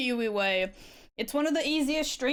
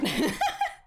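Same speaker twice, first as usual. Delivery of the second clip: high laugh. Real, not acted. Laugh about lucky playlist.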